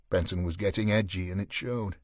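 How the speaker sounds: ordinary delivery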